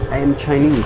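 ordinary delivery

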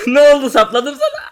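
normal talking voice

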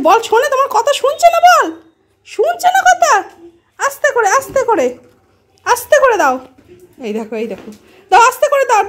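high, sing-song talk to a pet. Real, not acted. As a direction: coax the pet in a high playful voice. Calling pets playfully. (উঁচু গলায় পোষ্যের সঙ্গে আদুরে কথা)

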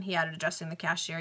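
plain reading voice

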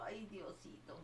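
a tired little whispered prayer; whispered sigh. Real, not acted. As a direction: whisper it under your breath. Sleepy aside to herself.

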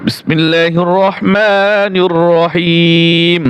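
slow, drawn-out Quran recitation; slow melodic tilawat; Quranic recitation. Acted. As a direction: intone the opening solemnly and slowly. Opens with recitation.

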